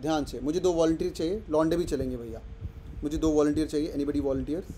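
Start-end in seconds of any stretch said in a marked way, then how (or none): none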